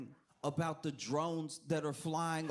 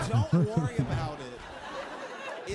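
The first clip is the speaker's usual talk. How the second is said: loud, slow mock reassurance; dismissive parent; 6 dB louder, slower than usual. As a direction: draw it out like an evasive parent. Government mimicked as broke parent.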